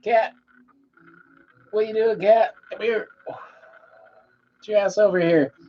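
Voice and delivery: coaxing a cat to come; calling the cat. Real, not acted.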